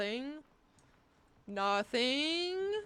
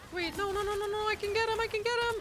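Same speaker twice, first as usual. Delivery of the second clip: frantic rising; 12 semitones higher, 5 dB louder, faster than usual. Real, not acted. Panicked chase in game.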